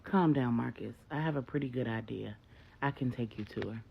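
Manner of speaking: low, soft and steady; calm, low reassurance to a frantic father. A narrator's reading. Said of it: "L J says".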